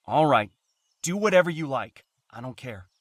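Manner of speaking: loud, low-pitched angry retort; angry dismissal. Acted. Man giving up the quarrel, loud and irritated. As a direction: throw it back at the partner, loud and fed up.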